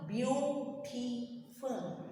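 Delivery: "beautiful" very slow in three beats; beau, ti, ful: "beautiful" with gaps between; syllable by syllable. Shown as a demonstration. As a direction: draw out each syllable of "beautiful". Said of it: "chin is getting down three times".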